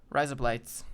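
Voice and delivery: mock-Australian, run together as one phrase; quick-joined Australian accent demo; Australian accent trick. Shown as a demonstration. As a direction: blend the words into Australian "razor blades". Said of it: "razor blades with an Australian accent".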